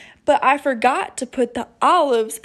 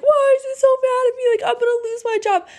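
much higher, fast, panicky inner voice; mock panic; 12 semitones higher, faster than usual. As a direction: rattle off the worry like a frantic self. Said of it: "been like".

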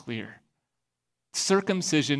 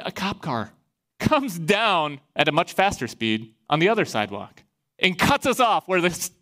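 ordinary talk, nothing marked

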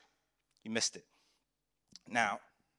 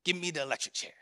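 mock-tough bragging wolf; lightly voiced swaggering wolf; 3 dB louder than usual. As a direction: puff up like a cocky wolf. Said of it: "The next big bad wolf says".

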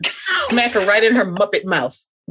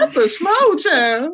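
teasing; 10 semitones higher, slower than usual. Real, not acted. Teasing host.

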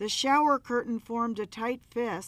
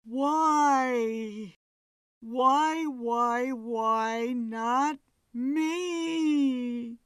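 crying moan; 4 semitones higher, 3 dB louder, much slower than usual. A narrator's reading. Medium channels ghost, moaning.

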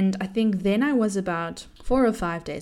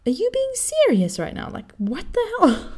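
much higher, louder, exasperated disbelief; freaking out; 13 semitones higher, 5 dB louder than usual. Retold or imitated. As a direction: squeal in frustrated disbelief. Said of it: "Freaking out entirely".

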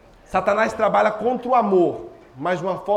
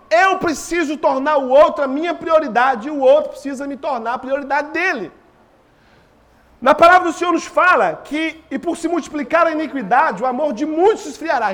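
preaching; 6 semitones higher, 6 dB louder than usual. Acted. Preaching with force.

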